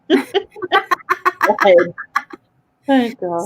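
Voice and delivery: laughing through the words; giggling out the phrase. Real, not acted. Laughing at own joke.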